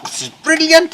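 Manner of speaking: high, loud burst of delight; thrilled exclamation. Acted. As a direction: cry out with sheer glee. Farmer thrilled on horseback.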